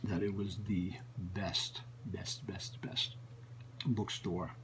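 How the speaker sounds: hushed whisper, trailing off; awed whisper. Retold or imitated. Telling of the rumored best bookstore in a reverent whisper. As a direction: whisper it like a sacred secret.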